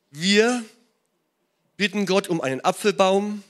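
emphatic preaching. Real, not acted. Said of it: "sermon emphasis".

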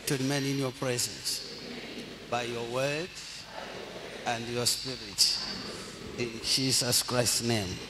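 quiet prayer. Acted. Pastor leading the congregation in a quiet prayer. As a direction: lead them gently in prayer.

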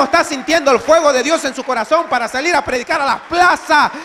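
high, very loud, impassioned sermon shout; full-force preaching. Real, not acted. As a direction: roar the questions out to the whole congregation. (prédica a gritos, con toda la fuerza)

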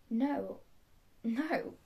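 ordinary talk, nothing marked

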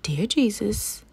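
ordinary delivery, nothing marked

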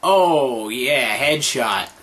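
ordinary talk, nothing marked

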